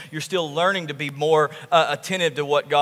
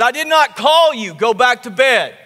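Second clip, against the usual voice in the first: fed-up priest, higher and louder; annoyed priest; 5 semitones higher, 5 dB louder than usual. Retold or imitated. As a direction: snap at the boy to go back to sleep.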